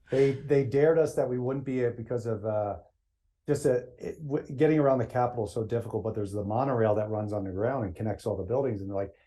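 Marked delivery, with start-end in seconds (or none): none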